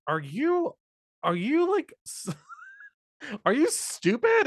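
high-pitched mock disbelief, halting start; incredulous. Host scolding a naive film character, incredulous. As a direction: squeak it out in exaggerated bafflement.

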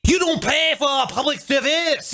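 high, hammed-up proclamation; lightly voiced Parisians jumping the turnstiles, mock rallying cry; mock rebellious French commuter. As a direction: declare the subway free like a mock revolutionary. Voicing French fare jumpers.

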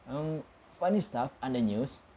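plain reading voice

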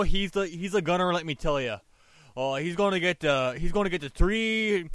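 hammed-up Canadian accent, loud and pumped; Canadian hockey guy. Hyping up his winner pick to friends in a Canadian accent. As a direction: sell the hockey player like a Canadian buddy. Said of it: "I gotta get in my Canadian mode here".

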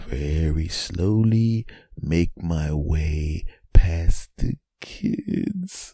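very slow, stretched-out words; creeping slowly. Acted. Invisible dad sneaking past the kids, creeping slow delivery. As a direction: drag each word as if tiptoeing.

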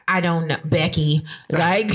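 normal talking voice

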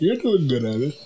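mid-yawn. Real, not acted. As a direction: say it through a yawn. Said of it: "Sorry, I'm kinda yawning right now".

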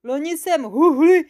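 high, loud muffled complaint; aggrieved monster talking through fabric; muffled mumbling monster. A narrator's reading. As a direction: grumble as if wrapped in cloth. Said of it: "ozvalo se huhlavě spod týpí".

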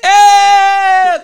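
a sudden loud, high hammed-up cry; mock anguished cry. Answering Júlio's jab, a hammed-up loud pained cry. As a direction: cry out as if stabbed, playing it up.

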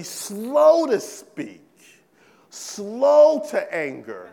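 "slow" stretched long and pushed; drawn-out emphasis. Shown as a demonstration. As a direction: linger heavily on each "slow". Preacher acts out the word slow.

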